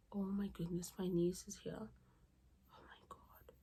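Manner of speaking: whispered aside, fading to almost nothing; hushed aside. Real, not acted. Vlogger whispers to herself as her niece turns up, hushed dismay. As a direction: drop to a secretive whisper.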